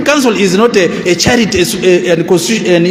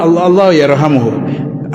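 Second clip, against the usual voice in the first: normal talking voice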